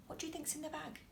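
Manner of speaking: soft hushed question; whisper. Real, not acted. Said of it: "question to listening child".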